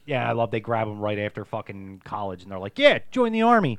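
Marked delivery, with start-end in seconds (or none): 2.8-3.8 higher, louder eager pitch; put-on recruiting shout; mock recruiter. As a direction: bark it like a pushy army recruiter. Acting out army recruiters.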